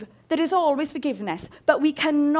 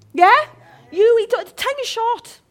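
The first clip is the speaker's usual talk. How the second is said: fired-up preaching; 7 semitones higher, 7 dB louder than usual. Real, not acted. Preacher rising to fury.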